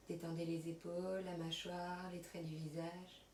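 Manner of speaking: soft, unhurried yoga nidra voice with long pauses; guided relaxation. Acted. Yoga teacher settling students on their mats, soft guided relaxation. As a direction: guide them quietly through body and breath.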